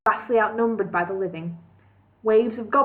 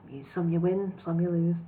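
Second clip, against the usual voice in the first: light, offhand and softer; lightly; 4 semitones lower, 5 dB quieter than usual. Acted. Elf shrugging off the lord's death, lightly and softly. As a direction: toss it off lightly. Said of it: "the elf added lightly".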